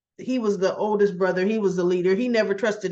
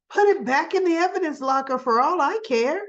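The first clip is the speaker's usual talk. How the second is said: higher-pitched mock irritation; dismissive order; 7 semitones higher than usual. Villain tells her to return the necklace, mock dismissive. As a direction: toss it off as an irritated imitation.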